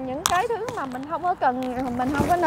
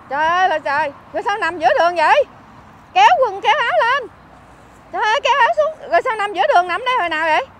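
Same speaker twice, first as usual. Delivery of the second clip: raised, higher voice of exasperated dismay; real alarmed scolding; 5 semitones higher, 6 dB louder, faster than usual. Real, not acted. Helper to Phong found lying in the road, loud dismayed scolding.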